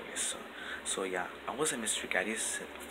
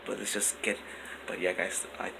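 usual speaking voice

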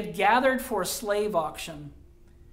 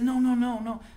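urgent protest; 4 semitones higher than usual. Acted.

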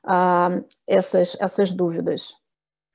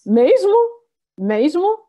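surprised; 8 semitones higher, 6 dB louder than usual. Real, not acted. Teacher reacts to surprising answer.

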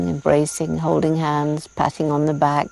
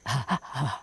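soft breathy mock chimp laughter; imitated chimp laugh; 3 semitones lower, 10 dB quieter than usual. Jane imitating a mother chimp laughing, soft panting laugh. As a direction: huff out a quiet ape laugh like the mother in the tree. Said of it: "and you hear her going".

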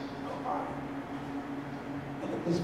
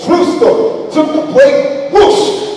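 excited; 10 semitones higher, 10 dB louder, slower than usual. Real, not acted. Dramatic aside, plate swiped.

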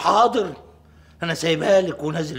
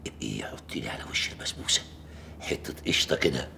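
unvoiced, fast secret request; whisper; 12 semitones lower, 8 dB quieter than usual. Father whispering to his daughter behind her mother's back. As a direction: whisper so mom won't hear.

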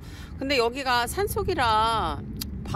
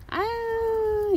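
very high, very slow aww; drawn-out coo; much slower than usual. Real, not acted. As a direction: let out a long adoring aww. Sighing coo. (아주 높고 길게 늘어지는 감탄)

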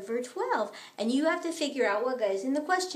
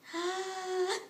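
gasp; 3 semitones higher, 6 dB quieter than usual. Acted. Baby realizes the answer.

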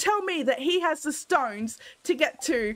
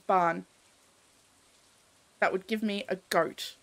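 ordinary talk

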